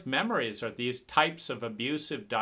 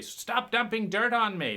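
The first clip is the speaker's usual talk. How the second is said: higher angry cry; angry protest; 7 semitones higher than usual. Retold or imitated. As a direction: push back, fed up. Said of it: "I keep crawling up and getting angry".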